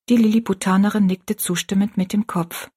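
ordinary delivery, nothing marked

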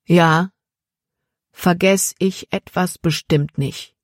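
slow, each word given weight; every word stressed. A narrator's reading. Jordan answers the bandit Sam, stressing every word. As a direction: press down on every single word. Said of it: "Jordan betonte jedes Wort".

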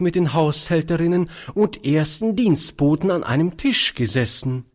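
put-on self-important elderly lady voice; prim old spinster. A narrator's reading. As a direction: boast of your fine manners like a starchy governess. Charlotte boasting of her propriety.

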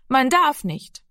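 put-on a boy clinging to what he was taught; small boy insisting.

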